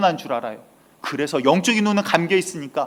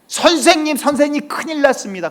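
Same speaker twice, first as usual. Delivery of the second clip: high-pitched panicked cry for the teacher; urgent alarm; 10 semitones higher than usual. Retold or imitated. Servant alerts his teacher to the surrounding army, urgent alarm. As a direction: act out a servant raising the alarm. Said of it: "급해지면 뭐가 나올까요".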